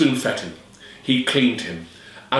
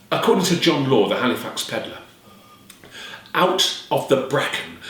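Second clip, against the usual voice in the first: ordinary delivery, nothing marked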